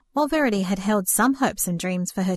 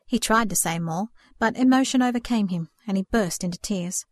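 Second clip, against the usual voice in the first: usual speaking voice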